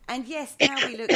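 ordinary talk, nothing marked

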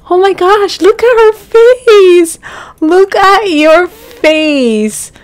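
high, loud, slow delight. Real, not acted. Player gushing at her child Sim's face, delighted. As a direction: gush over the cute face.

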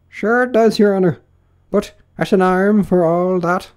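high, loud, insistent servant; servant Pat. Acted. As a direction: hold your ground in a high rustic voice. Pat insists to his master.

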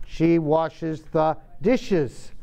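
slow, careful teacher model; slow model sentence. Shown as a demonstration. Teacher modelling a sentence for students, slow and careful. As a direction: spell it out slowly for the class. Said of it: "Yeah, washes".

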